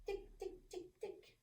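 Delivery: high whispered tic tic; tiptoe ticking. Acted. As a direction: tick softly like tiny mouse feet. Mouse tiptoeing sound.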